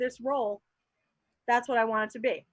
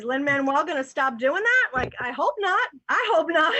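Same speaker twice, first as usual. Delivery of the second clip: excited; 3 semitones higher, 7 dB louder than usual. Real, not acted.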